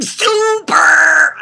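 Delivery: high, loud, drawn-out showman cry; over-the-top catchphrase impression; [name]. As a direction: belt it out like a posing cartoon hero. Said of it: "[name]'s doing all the poses".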